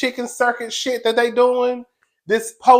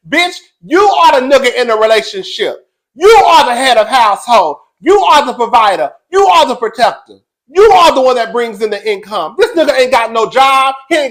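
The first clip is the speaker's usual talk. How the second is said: louder, pressing each "you are" home; emphatic lecture; 4 semitones higher, 7 dB louder than usual. Real, not acted. Talking to Robin about her man, loud and insistent. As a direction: drill it into her like a friend fed up.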